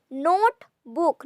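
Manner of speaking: "Note, book" with a break between syllables; split into syllables. Shown as a demonstration. Teacher showing syllables, "Note, book" split in two. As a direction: say "Note" and "book" as separate beats. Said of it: "Note is one syllable".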